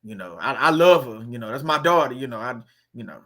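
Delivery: mimicking a parent. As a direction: mumble it low like a doting parent. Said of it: "a lot of people gonna say".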